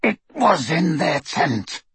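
slow, rasping werewolf voice. A narrator's reading. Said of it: "rasped Greyback".

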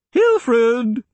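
high, loud call of a name. A narrator's reading. Old woman calling the bodyguard in, loud. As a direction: summon the guard through the door. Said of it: "she called".

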